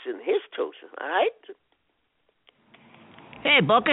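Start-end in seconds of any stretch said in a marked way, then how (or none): 3.5-3.9 calling out. Acted. Calling out to the garage.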